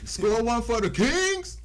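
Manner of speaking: loud, high, drawn-out cheer; put-on hype voice. Shown as a demonstration. Host demonstrates a line for co-host to repeat, high hype voice. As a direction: belt it out in a high, showy voice. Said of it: "that same voice".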